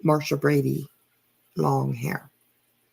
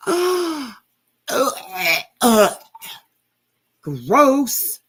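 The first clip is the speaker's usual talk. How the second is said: disgusted groan; 6 semitones higher, 6 dB louder, much slower than usual. Real, not acted. Disgusted reaction to clip.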